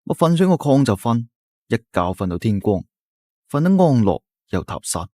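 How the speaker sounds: ordinary delivery, nothing marked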